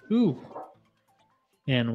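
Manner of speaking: ordinary talk, nothing marked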